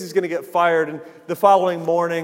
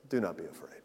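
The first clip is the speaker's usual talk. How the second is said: very soft and low; hushed reassurance; 17 dB quieter, faster than usual. Retold or imitated. Jesus to one who understands their sinfulness, hushed reassurance. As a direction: drop right down to gentle, hushed comfort.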